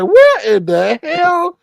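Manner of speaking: high, stretched outraged question; appalled high exclamation; shocked disbelief. Retold or imitated. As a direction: drag it out in disbelief. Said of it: "I was like".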